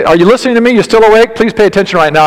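ordinary talk, nothing marked

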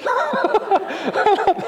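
high laugh. Real, not acted. Laughing at own dad quote.

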